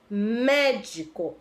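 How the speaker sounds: MÉdico said slowly, MÉ drawn out; stress on MÉ. Shown as a demonstration. Teacher having pupils notice MÉ in médico, slow stretched first syllable. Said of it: "Observe que o mé".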